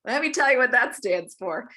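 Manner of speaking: pouncing on the question with enthusiasm; eager explaining. Retold or imitated. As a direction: jump in eager to explain tulip. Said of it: "I'm like".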